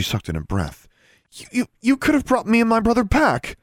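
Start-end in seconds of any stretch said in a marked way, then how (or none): 1.3-3.6 stammering, much higher and louder accusation; voice rising. A narrator's reading. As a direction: rise into an outraged, stammering cry. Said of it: "her voice rising".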